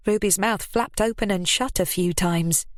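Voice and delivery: usual speaking voice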